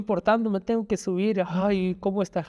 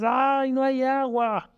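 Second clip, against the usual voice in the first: mock-annoyed sigh, raised higher; a touch of irritated complaint; 6 semitones higher, slower than usual. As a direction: act put out there is no water. Annoyed morning quote, acted.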